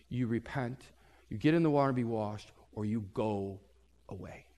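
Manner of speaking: low, soft and stern, fading away; low ultimatum. Retold or imitated. Prophet gives leaders his final ultimatum, low and stern. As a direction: hand down the final word quietly.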